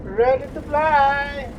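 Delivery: slow, high, loud hype call; excited announcement. Real, not acted. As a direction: stretch it out like a launch cry. About to take off in sim.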